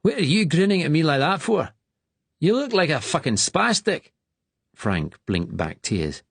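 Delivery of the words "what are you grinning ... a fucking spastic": menacing bully, high and loud; threatening. A narrator's reading. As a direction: threaten the new boy. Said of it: "One said threateningly".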